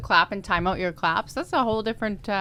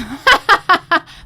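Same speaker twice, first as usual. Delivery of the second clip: loud high laugh; burst of laughter; 10 semitones higher, 9 dB louder than usual. Real, not acted. Hearing the counting task, laughing out loud.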